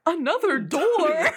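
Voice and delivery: slow, puzzled mock actor line; hammed-up dumb question, drawn out; bad acting. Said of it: "he's saying it like".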